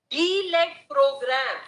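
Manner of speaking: "D led program" slowed and set apart; stress on D LED PROGRAM. Real, not acted. Counsel reading the clause to the judges, stressing "D led program". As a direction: dwell firmly on "D led program". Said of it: "I am emphasizing on the word D led program".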